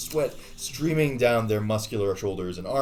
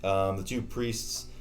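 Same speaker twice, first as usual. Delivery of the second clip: normal talking voice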